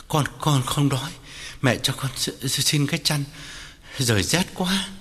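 weak, shivering stammer; trembling reply. A narrator's reading. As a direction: stutter as if chilled to the bone. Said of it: "Tân run run đáp". (run run, lắp bắp vì rét)

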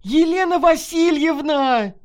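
very high, desperate scream of a name; panicked woman's yell; frantic wail. A narrator's reading. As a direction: cry out hysterically for help. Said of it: "истошно завопила волонтёр Марина Калинина".

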